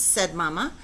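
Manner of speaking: ordinary delivery, nothing marked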